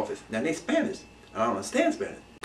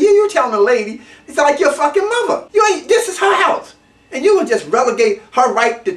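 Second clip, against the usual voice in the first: outraged; 9 semitones higher, 5 dB louder than usual. Real, not acted. Outraged rant at young dealers.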